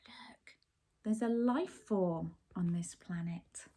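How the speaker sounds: hushed whisper. Acted. Captain whispering to crew about the life form. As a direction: whisper so the creature won't hear.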